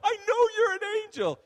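very high, very loud mock-hysterical yell; retold giddy shriek; gleeful shouting. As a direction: squeal it at the top of your voice. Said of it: "and I'm laughing. I'm going".

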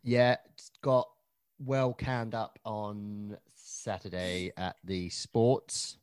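usual speaking voice

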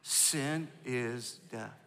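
very slow, quiet echo; slow repeat. Shown as a demonstration. Pastor asking the church to repeat it softly. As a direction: lay each word down softly and slowly. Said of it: "Say it again".

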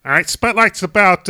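Chicago accent, big and brash. Shown as a demonstration. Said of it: "I'm doing a Chicago accent".

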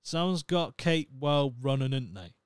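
broad Norfolk local quoted with relish; Norfolk accent. Retold or imitated. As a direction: Norfolk yokel voice. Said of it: "he came up with the phrase".

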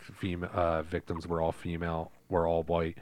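low, even fact-reading voice; reading aloud. Real, not acted. Host reading friends the true crime behind Scream, level reading voice.

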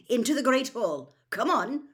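high, loud, brisk order; sharp teacherly command. A narrator's reading. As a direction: usher a crowd along sharply. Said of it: "said Professor McGonagall sharply".

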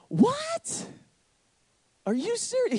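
sky-high squeaky What, mock shocked; shrill incredulous yelp, hammed up; stunned disbelief. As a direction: play the flabbergasted friend, voice jumping way up. Stunned reaction to God's command.

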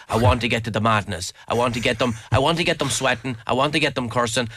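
normal talking voice

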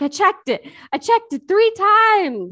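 high, loud frantic protest. Retold or imitated. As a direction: insist in a rising panic. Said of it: "He was like".

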